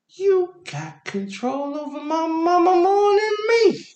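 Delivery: hammed-up childish indignation, stretched high; jealous kid. Jealous five-year-old addresses mom's boyfriend, high mock-indignant.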